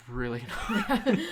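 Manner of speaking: laughing. Real, not acted. Laughing mid-sentence.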